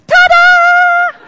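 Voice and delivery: high, loud, drawn-out ta-da; triumphant little boy. Retold or imitated. Four-year-old gymnast lands off the balance beam, triumphant shout. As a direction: shout it proudly, stretching the last syllable. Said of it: "he jumps off and says".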